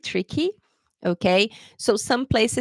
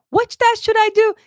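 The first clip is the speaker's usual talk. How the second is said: high, louder anxious student voice; worried friend; 13 semitones higher, 8 dB louder than usual. Retold or imitated. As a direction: play a confused student asking. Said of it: "friends who are struggling".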